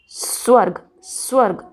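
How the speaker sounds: स्वर्ग with a light स joined to व; only half an s before "varg" in स्वर्ग. Shown as a demonstration. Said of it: "बहुत हल्का सा स निकाल रही हूँ". (स्वर्ग में हल्का आधा स)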